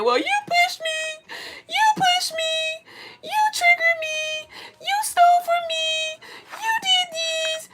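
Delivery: very high, drawn-out mock crying whine; mock complainer. Mimicking someone who keeps blaming others, high mock wail. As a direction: wail each accusation in a squeaky, stretched-out voice. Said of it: "are you gonna keep saying".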